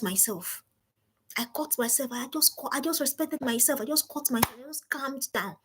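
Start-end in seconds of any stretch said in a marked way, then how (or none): none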